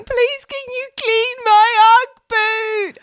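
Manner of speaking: high, loud mock-crying wail; tearful pleading. Crying girlfriend begging baby daddy for help, mock tearful. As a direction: beg in a drawn-out, hammed-up sob. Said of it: "I'm crying, saying".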